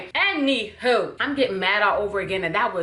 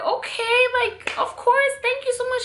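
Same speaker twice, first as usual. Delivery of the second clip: high grateful gush. Retold or imitated. Grateful acceptance retold.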